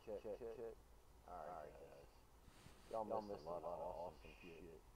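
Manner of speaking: faint distant echo of own voice; far-off playback copy; worn tape copy. Real, not acted.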